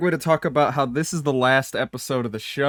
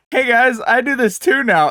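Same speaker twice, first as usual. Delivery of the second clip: high, bright-voiced hammed-up hello, much louder; playfully mocking the friend who joins in late; mock cheery greeting; 8 semitones higher, 4 dB louder than usual. Co-host mocks the guest's late arrival, high hammed-up hello. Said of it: "showed up and went".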